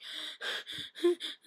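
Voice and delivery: mock crying gasps, short of breath; acting out breathless crying; imitated gasping sobs. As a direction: suck in air like an uncontrollable crying fit. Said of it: "I could not breathe. I was like".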